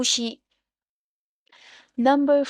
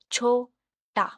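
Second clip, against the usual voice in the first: "छोटा" split as छो, टा; syllable by syllable; much slower than usual. Shown as a demonstration. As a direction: say "छोटा" in two separate beats. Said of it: "टू syllables". ("छोटा" दो टुकड़ों में, छो-टा)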